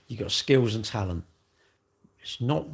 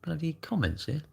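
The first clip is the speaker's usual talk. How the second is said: exasperated; 7 semitones higher, 5 dB quieter than usual. Retold or imitated. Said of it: "I go like".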